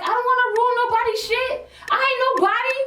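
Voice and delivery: high emphatic protest; self-deprecating. Retold or imitated. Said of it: "I'm like".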